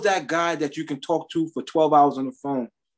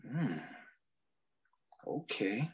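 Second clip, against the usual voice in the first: much softer, low, dazed; stunned murmur; 5 semitones lower, 17 dB quieter, slower than usual. Retold or imitated. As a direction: barely breathe it out in shock. Stunned reply, very soft.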